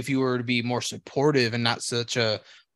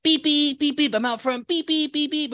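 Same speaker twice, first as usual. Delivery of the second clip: high, repeated honking beeps; imitated car horn; 14 semitones higher than usual. Friend honking out front for gym ride, imitated horn. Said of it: "And then it turned into".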